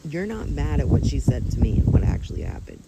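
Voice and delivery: heated pushback to the manager; angry retort. Retold or imitated. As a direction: fire it back at her, fed up. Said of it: "So now I'm already like".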